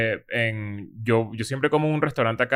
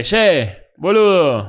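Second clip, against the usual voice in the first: mock Buenos Aires street slang, louder and higher; Argentine accent; 4 semitones higher, 5 dB louder, much slower than usual. Host in Buenos Aires imitating locals in an Argentine accent. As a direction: play an Argentine porteño calling out. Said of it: "Estamos por ahí en la calle".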